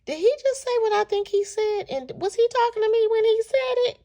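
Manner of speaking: high-pitched, incredulous questioning; can't-believe-my-ears squeal of questions; shocked disbelief. Retold or imitated. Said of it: "I was so shocked".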